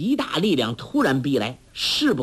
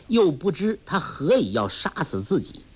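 usual speaking voice

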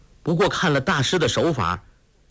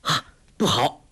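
sudden cry; 3 semitones higher than usual. A narrator's reading. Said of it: "忽然叫道".

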